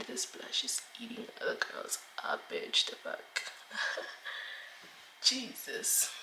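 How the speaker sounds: hushed, awed whisper. Real, not acted. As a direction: whisper it to yourself in awe. Awed aside about her blush.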